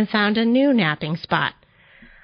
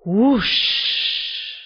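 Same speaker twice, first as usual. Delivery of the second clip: long airy whoosh; rushing sled noise fading out; imitated whoosh. A narrator's reading. Narrator imitating the sled taking off, airy whoosh.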